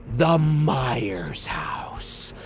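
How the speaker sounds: very slow, low, whispery reveal; ominous hush. A narrator's reading. Storyteller reveals the house through the windshield, ominous whisper. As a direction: draw it out low and breathy for suspense.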